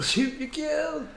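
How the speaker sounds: silly drawn-out echo. Pretend. Echoing the silly catchphrase.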